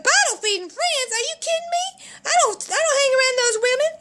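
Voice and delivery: high, hammed-up indignation; indignant disbelief. Mother rejects doctor's advice, high indignant disbelief. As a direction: scoff at it with the pitch well up.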